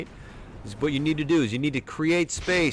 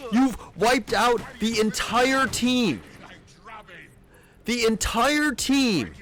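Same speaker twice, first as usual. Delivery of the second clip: higher and slow, stretching every word; drawn-out amazement; 10 semitones higher, much slower than usual. Real, not acted. Coach marvelling to the player at the team wipe, slow, higher amazement. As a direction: marvel at it slowly, really impressed.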